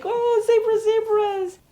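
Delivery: very high, loud squeal of delight; excited cry. Retold or imitated. Guest spotting zebras out the window, excited squeal. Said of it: "And I'm so excited".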